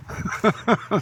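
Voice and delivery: airy helpless giggling fit; cracking up, can't hold it in; breathless giggle. Real, not acted. Telling friends about the climb, breaks into breathless giggles. Nervous laughter retelling climb.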